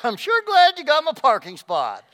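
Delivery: high and loud forced politeness; fake-sweet through gritted teeth; sarcastic.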